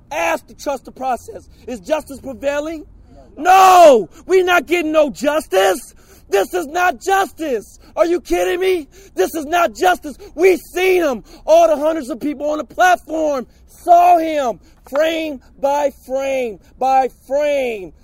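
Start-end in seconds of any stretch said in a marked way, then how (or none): 3.4-5.9 angry shout. Real, not acted. Angry protester at public hearing. 6.3-17.9 angry shouting. Real, not acted. Protester shouting at officials.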